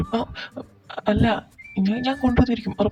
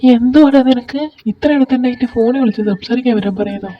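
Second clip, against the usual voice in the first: what's wrong with you, said loud; exasperated; 5 semitones higher, 8 dB louder than usual. Acted. Friend scolding JK for calling, louder and exasperated.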